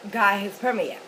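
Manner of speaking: ordinary talk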